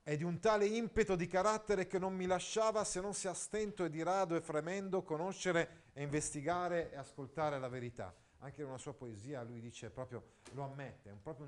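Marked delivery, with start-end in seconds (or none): 0.0-8.1 raised, earnest literary reading; reading a classic text aloud to class. Acted. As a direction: read the old prose with feeling, a bit higher. Reads Alfieri's autobiography aloud.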